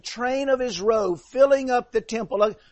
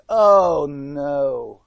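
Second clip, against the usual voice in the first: low, dismayed groan of oh no; 8 semitones lower, much slower than usual. Shown as a demonstration. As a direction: sink into a low oh no. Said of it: "what I do say is".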